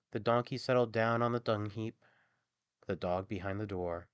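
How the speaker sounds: usual speaking voice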